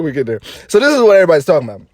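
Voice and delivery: ordinary delivery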